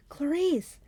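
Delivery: high shouted name; yell, unable to stand it; high-pitched cry of exasperation; exasperated yell. A narrator's reading. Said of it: "I yelled, unable to stand it".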